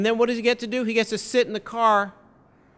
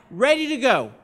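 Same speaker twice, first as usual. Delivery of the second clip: raised answering chant, falling at the end; yelled reply; 4 semitones higher, 4 dB louder than usual. Retold or imitated. People answering the campaign staff, raised chant. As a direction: echo the call back firmly. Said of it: "people would yell back".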